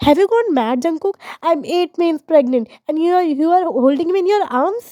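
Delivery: pregnant wife scolding husband for lifting her, angry. A narrator's reading.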